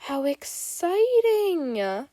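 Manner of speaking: slow, high, drawn-out delight; mock excitement. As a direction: savour it like a game. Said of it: "he teases".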